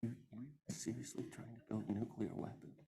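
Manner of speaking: very soft, hushed imitation of a gentle teacher; gentle whisper. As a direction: say it barely above a breath, mildly. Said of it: "he whispers".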